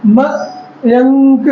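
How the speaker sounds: Mayank stretched very slowly with no break; teacher showing the voice running on through a name; very slow sounding out. Shown as a demonstration. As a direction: draw Mayank out in one smooth line. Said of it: "आवाज regular continue चल रही है".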